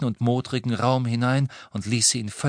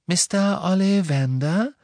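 high, rising questioning call; gentle high-pitched query; Luna; 8 semitones higher, 4 dB louder than usual. A narrator's reading. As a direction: call politely in a light girl's voice. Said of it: "konnte Harry Luna sagen hören".

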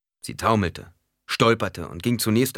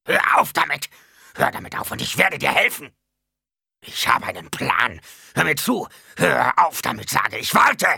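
loud, high, frantic shouting; screaming old Baron; 8 semitones higher, 6 dB louder than usual. A narrator's reading. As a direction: shout desperate orders to stop someone jumping. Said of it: "der alte Baron schrie noch immer". (verzweifelt schreiender alter Baron)

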